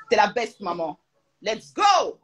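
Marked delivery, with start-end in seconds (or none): 1.4-2.2 higher, louder hype shout; cheering loudly at the camera; excited cheer. Real, not acted. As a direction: pump up the room. Cheering as mum leaves.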